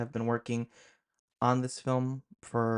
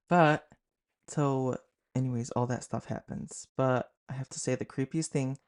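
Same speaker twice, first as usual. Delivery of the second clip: plain reading voice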